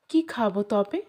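ordinary delivery

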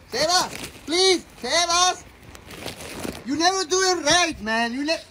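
loud, high, exasperated nagging; exasperated scolding. Acted. Wife scolds husband in skit.

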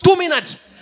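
high, loud hammed-up boast; excited punchline. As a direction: sell the speed like a miracle. Punchline about fast US police.

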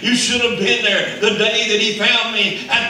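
loud, high preaching cry as the woman at the well; voicing the woman. Preacher voicing the woman at the well in a loud high cry. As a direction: shout her joy to the crowd.

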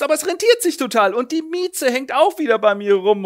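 high fast bragging; a show-off painter bragging; gleeful boasting. Acted. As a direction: brag with glee. Bragging neighbour voiced.

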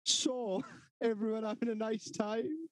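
high-pitched, rising mock-cheerful question; squeaky, breezy mock small talk; reset chirpy host. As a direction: imitate a drunk suddenly all sociable. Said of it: "then again, he'd be like".

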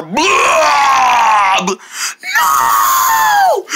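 slow, drawn-out crying shout building to a very high scream; comic wail. Acted. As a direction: wail it like a tragedy. Blob wails over macaroni loss.

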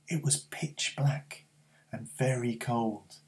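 slow, hushed whisper; slow whispered narration of the night. A narrator's reading. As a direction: whisper it into the cold dark. Creeping out into the dark.

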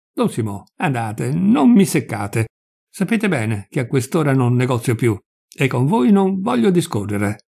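plain reading voice

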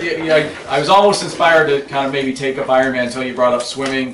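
normal talking voice

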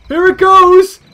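loud, high, gleeful call; excited shout. Real, not acted. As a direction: yell it out as chaos starts. Launching rampage, excited shout.

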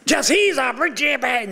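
high mocking take on the wife's words; a husband hearing his wife expose him; wife as he heard it. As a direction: play up how he heard her. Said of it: "she just throws me under the bus".

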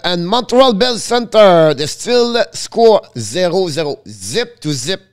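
high, loud and slow intro; booming "here we go" to start the recap; big announcer opening. Real, not acted.

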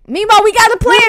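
ordinary talk, nothing marked

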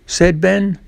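ordinary delivery, nothing marked

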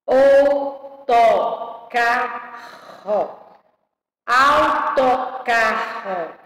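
slow "Autocarro", au-to-car-ro in four spaced beats; syllable by syllable. Shown as a demonstration. Teacher showing pupils four syllables, syllable by syllable. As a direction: sound out "Autocarro" one syllable at a time. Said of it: "Quatro sílabas".

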